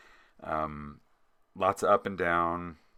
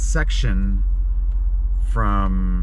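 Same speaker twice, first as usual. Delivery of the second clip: road noise; 11 dB louder than usual. Real, not acted. Recorded from moving vehicle.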